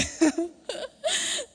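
high laugh, half crying; tearful laugh. Real, not acted. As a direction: laugh through tears. Laughing at husband's answer. (又哭又笑)

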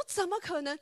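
high, loud, disbelieving; retold astonishment. Friend hearing the vegetables were rotten, shocked and loud. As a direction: gasp it out, shocked. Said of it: "你知道他非常非常讶异".

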